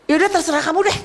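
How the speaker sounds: hammed-up fed-up parent; exasperated parent. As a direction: throw up your hands at the child. Fed-up parent to whining child.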